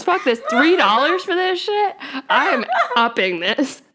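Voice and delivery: loud, high, laughing indignation; laughing mock outrage. Real, not acted. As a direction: joke it up with fake outrage. Joking about OnlyFans price.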